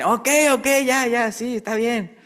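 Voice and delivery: high, exasperated ok ok, caving in; mock-give in like a worn-down parent; parent giving in. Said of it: "y de repente ya dicen".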